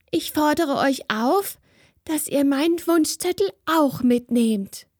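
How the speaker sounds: put-on a little girl trying to sound solemn and fumbling; nervous spirit girl. A narrator's reading.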